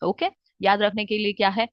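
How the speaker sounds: ordinary talk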